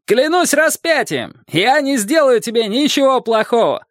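high, drawn-out calls; slow storybook shouting, pitched well up; shouting across water. A narrator's reading. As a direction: call out orders from the island. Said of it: "крикнул человек на острове".

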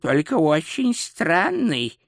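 lingering, hesitant verdict; puzzled wonder. Acted. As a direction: draw it out, mystified. Astrologer puzzled by the horoscope.

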